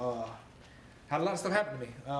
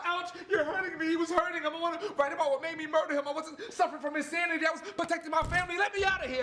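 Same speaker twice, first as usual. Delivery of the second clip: high-pitched, fast desperate outburst; pleading, pressured spoken-word climax; anguished outcry; 3 dB louder than usual. Acted. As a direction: cry out in pain and protest at the poem's peak. Poem climax, abuse and confession.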